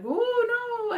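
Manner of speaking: high drawn-out cry of mock dread; mock alarm. Teller mimics the jungle adventure ride, mock alarm. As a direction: wail it high, hamming up the ride's danger. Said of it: "had, like, a little voiceover".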